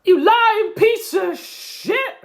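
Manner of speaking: very high, slow screechy impression of Brian; squeaky mocking impression, drawn out. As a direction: shriek it slowly in a cartoonish high voice. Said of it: "losing the Brian voice".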